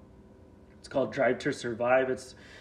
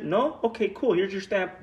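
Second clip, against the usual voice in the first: higher, carefree okaying voice; mock breezy inspector; 8 semitones higher, 4 dB louder than usual. Inspector waving the captain's boat through, breezy mock voice. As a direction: toss it off like a lazy inspector who doesn't care. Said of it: "they're like".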